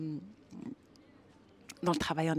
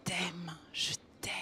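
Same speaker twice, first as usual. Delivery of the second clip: put-on soft, hushed tenderness to the inner child; tender whispered I love you; 6 dB quieter than usual. Shown as a demonstration. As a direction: whisper it like cradling a baby. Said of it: "Et tu lui dis juste".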